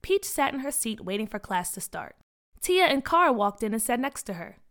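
ordinary delivery, nothing marked

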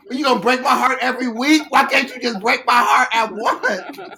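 high, very loud hammed-up heartbreak; anguished yelling. Fan ranting at [name] about new releases, mock-anguished yell. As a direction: wail it in mock outrage.

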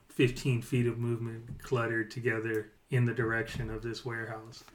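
ordinary talk, nothing marked

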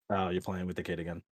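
ordinary talk, nothing marked

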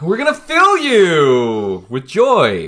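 slow, loud, sing-song cover-up; fake cheerful. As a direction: sweeten it over, drawn out.

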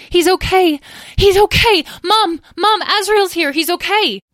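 high, loud, excited shouts; crying out. A narrator's reading. Petra telling Mom the brother is safe, overjoyed cry. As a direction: call out the good news. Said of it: "Petra cried out".

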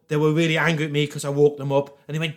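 ordinary talk, nothing marked

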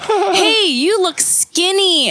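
high, slow, put-on sweet greeting; fake compliment. Mom calling her daughter, mocked as fake and high. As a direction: gush it, clearly insincere. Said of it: "very not, like, fake way".

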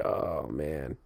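low, soft, weary groan of words; imitating listless fish. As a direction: play a worn-out fish sighing its complaint. Imitates exhausted pickerel lying there.

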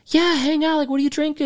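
very high-pitched, eager invite; mock welcoming group. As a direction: play the group happily waving you in. Acts the group welcoming him in.

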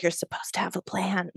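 breathy hushed whisper. Retold or imitated. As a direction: whisper a secret nudge to him. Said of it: "I just whispered".

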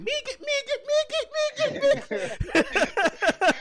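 laughing mock voice. Mocking the man grabbing lady.

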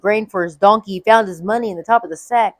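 plain reading voice

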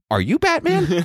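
squeaky high voice asking are you Batman; high mock question. Fan at a masquerade spotting Bruce, high squeaky question. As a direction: pitch way up like a starstruck fan.

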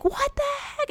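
very high mock shocked cry. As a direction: squeak it like a horrified teen. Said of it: "she's like".

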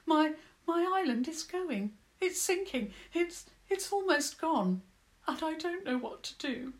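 a touch of crying in the mole's voice; tearful. A narrator's reading. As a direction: hold back tears as the island sinks. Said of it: "eyes filled suddenly ... with tears".

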